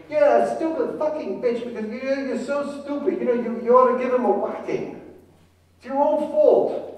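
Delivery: loud, high furious yelling; angry grandmother. Retold or imitated. Grandmother yelling down from the window, high and furious. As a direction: scream abuse down at the daughter. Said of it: "I'm just quoting".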